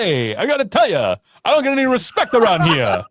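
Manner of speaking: put-on comic's complaint with swooping pitch; Rodney Dangerfield. Mocking a colleague's British accent, hammed-up Dangerfield voice. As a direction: grumble it out as a hammed-up stand-up impression. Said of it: "That's what he sounds like".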